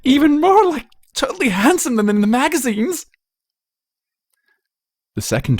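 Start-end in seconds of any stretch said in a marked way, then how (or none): 0.0-3.0 put-on much higher, louder valley-girl squeal; gushing excitement. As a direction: swoon over him breathlessly. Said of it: "in excitement".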